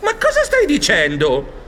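high squeak then baffled protest; very high mouse squeak, then high confused denial; dumbfounded squeak. Acted. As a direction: squeak in pure shock. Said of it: "lo guardavo allibito".

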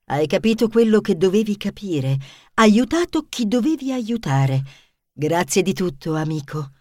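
calm wise fox; enigmatic fox. Acted. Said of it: "Volpe aggiunse enigmatica".

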